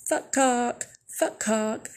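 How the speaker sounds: mock yell. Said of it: "we're just randomly yelling".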